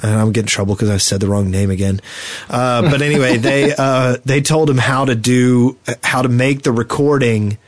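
ordinary talk

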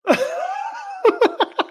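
sudden high burst of laughter; laughing. Real, not acted. Host hears the window story.

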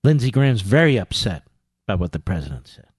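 low mock-grave voice, softening away; mock upset. Host mocking an upset senator, low and mock-solemn. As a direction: let feigned dismay sink to a low murmur.

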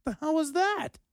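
high, put-on snail voice; snail's punchline voice. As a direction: squeak it like an indignant little snail. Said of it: "And the snail says".

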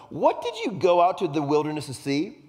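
high, loud challenging question; a little angry. Acted. Jesus turns to the crowd about John, frustrated. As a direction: challenge the crowd with mild anger. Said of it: "Jesus is a little angry".

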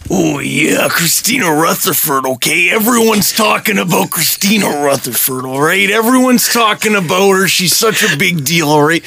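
wrestling promo voice with punchy repeated okays; Macho Man. Acted. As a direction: cut a cocky wrestler's promo. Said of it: "in your wrestling voice".

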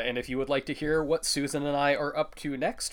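ordinary delivery

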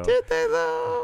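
very high, drawn-out squeak; teasing doubt. Pretend. Co-host teasing the invention claim, high drawn-out doubt. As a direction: question it in a slow, high silly voice.

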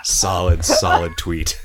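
ordinary delivery

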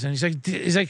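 ordinary talk

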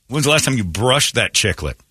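normal talking voice

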